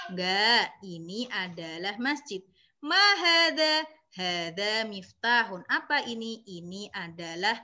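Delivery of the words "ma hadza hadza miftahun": hadza maktabun, each vowel drawn out; slow vowelled reading. Shown as a demonstration. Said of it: "sambil diharokati". (hadza maktabun dibaca pelan dengan harakat)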